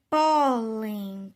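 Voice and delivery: pólen with PÓ loud and drawn out; penultimate stress demo. Shown as a demonstration. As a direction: hit and hold the PÓ in pólen. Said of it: "paroxítonas terminadas em N".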